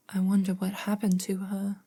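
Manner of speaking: plain reading voice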